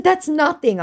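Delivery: high dismissive scoff. Retold or imitated. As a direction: brush off the price with a high scoff. Scoffing at the price.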